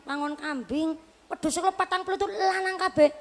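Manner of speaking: usual speaking voice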